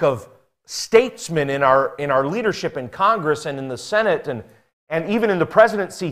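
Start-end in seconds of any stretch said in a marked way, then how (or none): none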